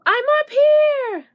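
high, loud and slow, sung out to a friend below; calling down from above. A narrator's reading. Butterfly calls down to his friend below, high and loud. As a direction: ring it out high from up in the sky.

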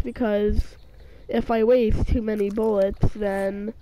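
ordinary talk, nothing marked

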